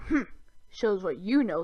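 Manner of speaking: grumbling dwarf voice, lightly voiced; dismissive little grumble; huffy dwarf. A narrator's reading. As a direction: huff it like a grumpy dwarf. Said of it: "the dwarf huffed".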